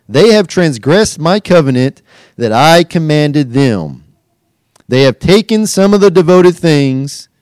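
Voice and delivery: loud, commanding voice of God; God's rebuke. Acted. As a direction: thunder the rebuke down on Joshua. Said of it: "The Lord said to Joshua".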